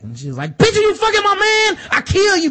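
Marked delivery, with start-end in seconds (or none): 0.6-1.8 jealous screech. Retold or imitated. Jealous wife confronts Sansa. 1.9-2.5 high, loud screech of a threat; shrill loud death threat; shrieked threat. Retold or imitated. Lysa threatening Sansa.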